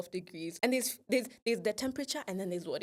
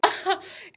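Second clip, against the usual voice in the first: high breathy laugh; 12 semitones higher, 8 dB louder than usual. Real, not acted. Laughing at the memory.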